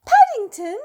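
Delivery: high, loud astonished cry; mother cries out on finding Paddington; surprised exclaim. A narrator's reading. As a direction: cry out his name in astonishment. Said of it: "exclaimed Missus Brown".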